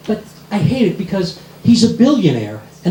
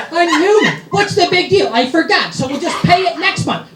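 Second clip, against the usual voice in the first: breezy wife; 9 semitones higher than usual. Retold or imitated. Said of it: "she's right along with it".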